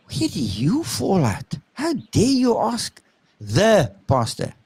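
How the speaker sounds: hammed-up affront, stretching each word; mock indignation. Shocked congregation to someone questioning the pastor, mock indignant. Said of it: "everybody kinda looks at you and says".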